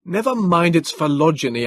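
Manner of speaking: high, loud urgent cry; telling the Morlock to stop lecturing, loud; cried. Acted. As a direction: shout over the danger. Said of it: "I cried".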